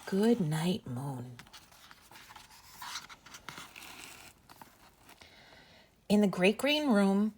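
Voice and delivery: gentle sing-song bedtime read-aloud; reading to children. Acted. Reads bedtime story to children.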